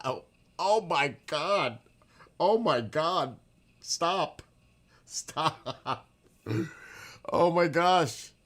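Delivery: real shock, loud and high with a laugh; shocked outburst. Real, not acted. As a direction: cry out in real disbelief. Shocked at the chat's story.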